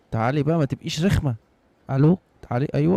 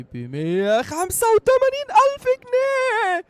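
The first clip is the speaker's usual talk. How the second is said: much higher, slow, put-on seller voice; mock shopkeeper price voice; slower than usual. Player voicing the shopkeeper quoting a huge price, squeaky mock seller voice. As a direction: announce the price like a silly game shopkeeper.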